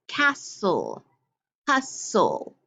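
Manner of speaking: castle and hustle in two beats with silent t, CAS-sul, HUS-sul; syllable-by-syllable reading. Shown as a demonstration. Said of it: "listen to when I read these words".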